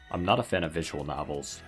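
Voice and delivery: usual speaking voice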